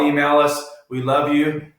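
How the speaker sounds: ordinary talk, nothing marked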